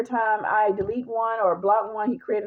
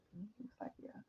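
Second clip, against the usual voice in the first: whispered; 3 semitones higher, 26 dB quieter than usual. Retold or imitated. Dad's reply, unvoiced.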